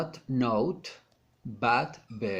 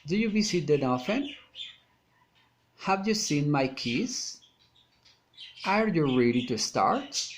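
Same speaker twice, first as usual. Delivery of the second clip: questions lifting at the end, on "often", "keys" and "start"; rising intonation. Shown as a demonstration. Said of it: "rising intonation".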